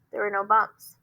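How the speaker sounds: ordinary talk, nothing marked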